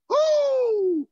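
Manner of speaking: high, loud praise whoop; joyful whoop. Real, not acted. Pastor whooping in praise to God. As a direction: let out a holy whoop. Ecstatic cry.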